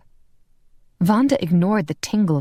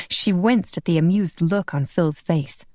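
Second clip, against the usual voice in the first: usual speaking voice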